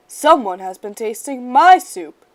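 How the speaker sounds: loud bear roar. A narrator's reading. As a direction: roar at the spilled soup. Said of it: "he roared".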